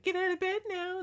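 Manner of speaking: very high bossy cat voice; demanding cat voice. Retold or imitated. Cat demanding his owner get up, in a very high voice. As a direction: order her out of bed like a cat. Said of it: "he's like".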